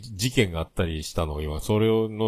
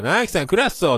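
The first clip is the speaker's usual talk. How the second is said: 高く威勢よく博多弁で凄む先輩役; 後輩に喧嘩腰で挨拶する先輩の真似; 博多の先輩のモノマネ; 9 semitones higher, 3 dB louder than usual. Retold or imitated. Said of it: "その先輩が、". (博多弁の荒っぽい先輩の挨拶)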